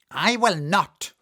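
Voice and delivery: high, loud witch snap; defiant old witch; witch retorting. A narrator's reading. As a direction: bark the refusal as the witch. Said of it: "retorted the witch".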